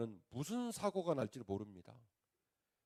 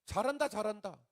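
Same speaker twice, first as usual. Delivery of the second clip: high, loud sarcastic cheer; a pastor's hammed-up thank-you; mock cheering; 9 semitones higher, 7 dB louder than usual. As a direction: cheer it on mockingly. Mocking praise of offering.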